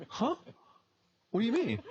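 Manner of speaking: a baffled, high-pitched comeback; puzzled. Retold or imitated. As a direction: react as if caught off guard by the boss. Acted puzzled reply to his boss.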